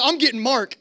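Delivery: high-pitched, flustered announcement; mock an upset watchman threatening to tell; retold indignant threat. Night watchman threatens cabin with the director, imitated indignation.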